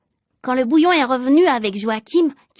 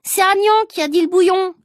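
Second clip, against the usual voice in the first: put-on higher, louder boy's protest; shouted protest; 6 semitones higher, 4 dB louder than usual. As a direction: call out to defend a friend. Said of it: "a crié Rufus".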